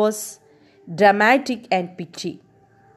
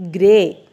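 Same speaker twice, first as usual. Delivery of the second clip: "gray" said louder, set apart on its own; stress on GRAY; 5 dB louder than usual. Shown as a demonstration. Teacher repeats the squirrel's colour for the class, stressing "gray". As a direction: lean firmly on the word "gray". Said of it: "I again stress the word".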